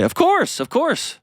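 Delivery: husband rushing to reassure his wife; eager husband. Retold or imitated. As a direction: jump up to reassure her at once. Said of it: "he's gonna say".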